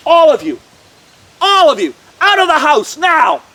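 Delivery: loud, commanding order; authoritative. A narrator's reading. Jesus ordering mourners out of the house, authoritative. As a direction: shout it with full authority. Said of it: "such an authoritative voice".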